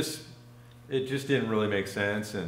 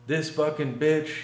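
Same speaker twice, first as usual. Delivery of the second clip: higher, drawn-out Southern man's voice; Southern accent; 6 semitones higher than usual. Retold or imitated. Storyteller imitating the man as the couple come in, Southern accent. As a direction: imitate a Southerner cursing his girlfriend. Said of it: "they both had Southern accents".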